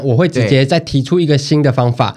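ordinary delivery

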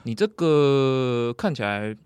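very slow, deadpan bad news; doctor breaking bad news in mock calm, slowly. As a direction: drag it out like an unmoved doctor. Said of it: "医生一脸很淡定".

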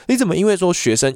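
ordinary talk

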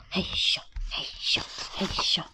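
hushed heave-ho; whispered effort. Acted. Bear sneaking to the chimney at night, whispered effort. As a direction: whisper each little grunt of climbing.

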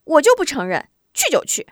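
high, loud defiant retort; defiant girl. Acted. Sister refuses to be called a coward, defiant high retort. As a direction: toss your head and refuse to back down. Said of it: "突然一甩小辫儿".

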